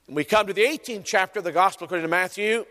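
normal talking voice

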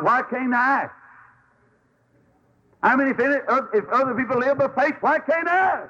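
preacher recalling his plea to walk with God; fervent preaching. Acted. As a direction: cry out to the Lord. Preacher retells his prayer.

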